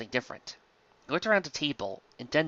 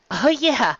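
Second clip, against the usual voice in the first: playful toy voice; 9 semitones higher, 5 dB louder than usual. Acted. Host voicing a plush toy.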